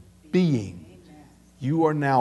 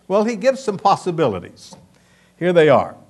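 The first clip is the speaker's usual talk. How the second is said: ordinary delivery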